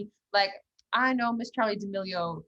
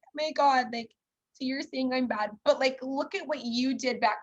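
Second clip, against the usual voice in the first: higher-pitched mock protest; mock defensive; 5 semitones higher than usual. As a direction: imitate someone hurt at being called out. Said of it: "people hate being called out".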